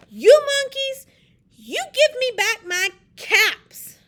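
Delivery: high, loud storybook shout; hammed-up angry holler; angry peddler. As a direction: demand it crossly at full volume. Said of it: "This made the peddler angry".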